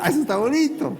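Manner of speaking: high-pitched, marvelling exclamations; acted delight. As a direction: play being thrilled by a new power. Moisés fascinado con el milagro. (asombro entusiasmado, voz aguda)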